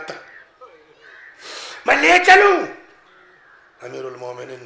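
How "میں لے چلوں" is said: much higher and louder, crying out the offer; impassioned cry. Acted. Preacher repeats Qanbar's offer to the gathering, impassioned loud cry. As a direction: cry it out with fervour to the mourners.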